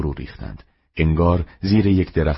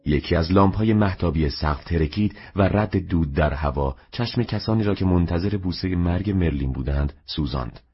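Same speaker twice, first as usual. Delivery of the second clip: ordinary talk, nothing marked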